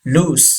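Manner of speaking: LOOSE ending in a hissed S; S sound. Shown as a demonstration. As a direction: end "loose" on voiceless "ss". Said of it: "सीन की आवाज आएगी". (loose के अंत में "स" की आवाज़)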